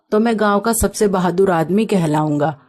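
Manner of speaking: plain reading voice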